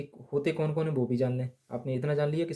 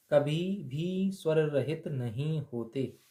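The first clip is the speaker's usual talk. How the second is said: very slow, each word spaced out; slow emphatic; much slower than usual. Shown as a demonstration. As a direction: lay the rule down slowly, word by word. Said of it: "ध्यान रखना इस बात के लिए". (धीमे-धीमे, हर शब्द पर ज़ोर देकर)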